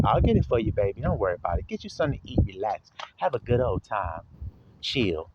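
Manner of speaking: low, soft coaxing; wife soothing her husband, low and hushed. Retold or imitated. Voicing Jezebel soothing Ahab.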